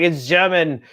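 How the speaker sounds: put-on German sliding into high, slow Australian accent; muddled Australian accent. Dad explains his accent to daughter, muddled Australian. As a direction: drift from German into drawn-out Australian. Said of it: "Australian for some reason".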